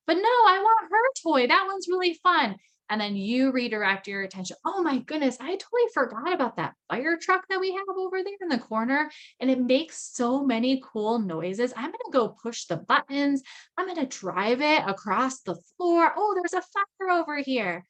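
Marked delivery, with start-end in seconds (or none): 0.1-2.6 put-on high, loud whining kid; whiny child. Son demanding his sister's toy, high and whiny. 4.6-17.8 high, bright play-acting excitement; excited redirection. Parent luring son away from sister's toy, excitedly higher.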